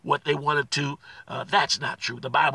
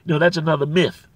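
loud, rising preacher's cry on Jesus Christ; preaching with fire; 2 semitones higher, 5 dB louder than usual. Acted. Pastor exhorting his brothers and sisters, loud preaching. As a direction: proclaim it to the back row.

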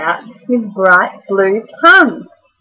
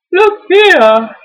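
high, loud stretched yawn; sleepy koala's yawned words; 7 semitones higher, 5 dB louder than usual. A narrator's reading. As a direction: speak through a big acted yawn. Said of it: "he yawned".

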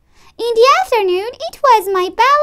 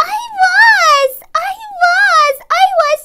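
put-on high, bouncy child's delight; cheerful glee; 7 semitones higher, 4 dB louder than usual. A narrator's reading. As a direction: announce it high and proudly. Child gleefully reports cheerfulness.

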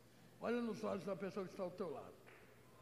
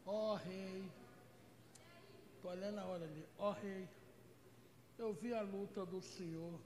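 fawning flatterer; 3 semitones lower, 5 dB quieter than usual. Retold or imitated. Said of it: "vocês conhecem uma pessoa falsa?".

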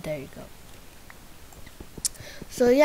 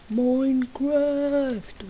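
drawn out; 6 semitones higher, much slower than usual. Acted. Dramatic drawn-out intro word.

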